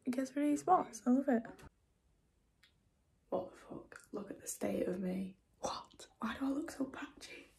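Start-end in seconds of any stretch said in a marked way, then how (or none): 3.3-7.5 unvoiced, hushed dismay; stunned whispered panic at a patchy tan; shocked whisper. Real, not acted. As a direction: whisper it in horrified disbelief.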